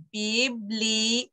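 "Biblioteca" slowly broken into syllables; slow teaching pronunciation of "Biblioteca". Shown as a demonstration. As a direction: sound out bi, bli, o, te, ca. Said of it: "Biblioteca is a long word".

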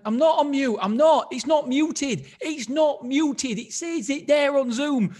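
high, loud protest; mock indignant insistence to the panel; insisting. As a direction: stubbornly deny being muted. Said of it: "I'm like".

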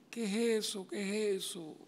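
high, soft disbelieving question; acted dismay. Preacher lamenting churches going separate ways, acted dismay. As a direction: ask it high and hushed, in disbelief.

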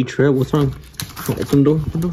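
ordinary delivery

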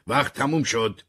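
loud, high call down the hallway; guard's shout. A narrator's reading. Guard calling down the corridor that time's over, a loud shout. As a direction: bark out that time is up. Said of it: "نگهبان از راه رو داد زد".

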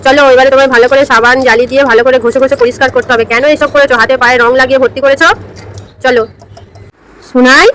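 ordinary delivery

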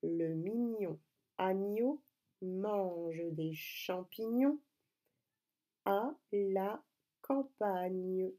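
very slow, syllables stretched out; slow dictation. Shown as a demonstration. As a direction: dictate it word by word for young writers. Dictating whole sentence to pupils. (dictée très lente et articulée)

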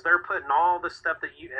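ordinary talk

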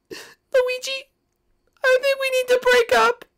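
high-pitched princess, hurt and firm; Daisy's high voice. Acted. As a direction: pitch way up and shut him down.

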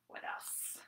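whisper. Acted. Pocket search.

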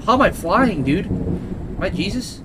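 high-pitched, incredulous questioning; amazed disbelief. Real, not acted. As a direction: ask it in wonder, voice shooting up. Amazed at flying in game.

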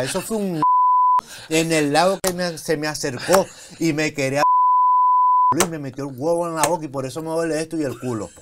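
quoting himself while cracking up; laughing through it. Retold or imitated. Guest quoting his drugged self to host, laughing. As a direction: push the words out between fits of laughter. Retelling drugged hospital ramblings.

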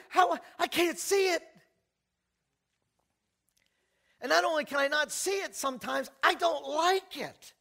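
high, loud prayer cry; preaching at full force. Real, not acted. Preacher cries out to God before the congregation, loud and high.

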